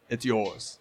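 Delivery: low, soft creature voice; Basuk. Acted. Companion points Greta to the helm, low quiet voice. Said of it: "points to it and says".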